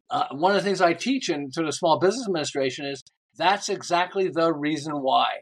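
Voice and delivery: ordinary delivery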